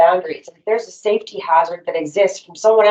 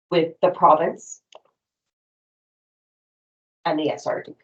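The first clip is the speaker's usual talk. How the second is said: dictating. Shown as a demonstration. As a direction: pause between phrases for the note-taker. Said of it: "would you read that back to me?".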